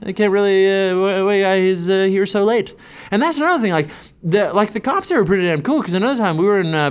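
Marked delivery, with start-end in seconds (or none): 0.0-2.7 halting, higher, broken-English stammer; mock stoned confusion. Stoned comic stammering at the cops in broken English. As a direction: play dumb to the police. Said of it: "and I was like".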